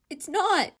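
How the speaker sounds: high, full-throated scream of no; desperate shout cutting the voices off; screamed denial. Acted. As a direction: break the argument with a scream. Inner argument breaks into scream.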